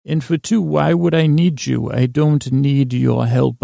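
normal talking voice

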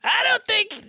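mock protest. Host objects to joke about their group breaking up, mock protest. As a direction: protest in a high, hammed-up voice.

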